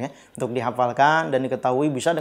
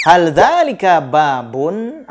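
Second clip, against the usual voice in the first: slow question; 3 semitones higher, 5 dB louder, slower than usual. Shown as a demonstration. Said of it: "masih dengan kata hal".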